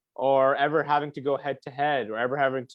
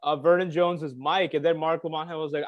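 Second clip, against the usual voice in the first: ordinary delivery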